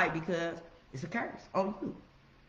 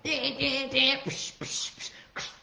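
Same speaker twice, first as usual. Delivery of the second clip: high chiming dings then hissed psh sounds; playful mouth noises; mouth sound effects; 7 semitones higher, slower than usual. Retold or imitated. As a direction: imitate bells and air bursts with the mouth.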